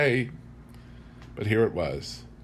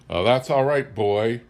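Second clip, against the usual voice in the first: ordinary delivery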